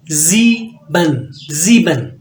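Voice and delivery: ज़ीबन drawn out slowly with a z start; German sieben. Shown as a demonstration. Teacher models German seven, slow and clear. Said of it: "जेड की आवाज़ देगा आपको".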